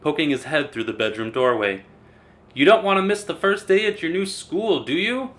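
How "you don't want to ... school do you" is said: higher, gently coaxing dad; coaxing father. A narrator's reading. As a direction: tease her out of bed. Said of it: "Mister Hartwell said".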